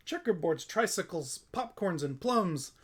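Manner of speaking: ordinary delivery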